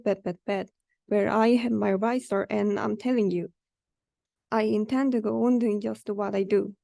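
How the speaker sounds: plain reading voice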